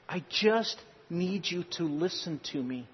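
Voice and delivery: soft plea. Retold or imitated. Wife pleading with husband to listen, soft and slow. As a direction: ask gently and slowly to be heard. Wife's plea in retold argument.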